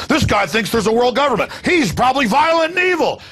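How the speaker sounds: hammed-up accusation; mock alarmist accuser. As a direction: imitate someone sounding the alarm, climbing in pitch. Voices alarmed media accusers.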